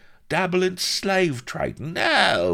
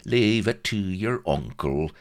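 higher, oily reassurance; unctuous; 6 semitones higher, 4 dB louder than usual. A narrator's reading. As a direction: reassure him like a slippery fixer. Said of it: "said Vladimir unctuously".

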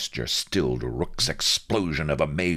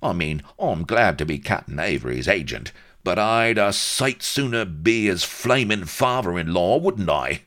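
higher, emphatic Cockney grumble; aggrieved Cockney agent; 6 semitones higher, 5 dB louder than usual. A narrator's reading. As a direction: wish aloud with Cockney envy.

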